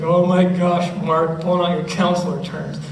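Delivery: teasing student. Retold or imitated. Student teases Mark about counselor terms, light mock.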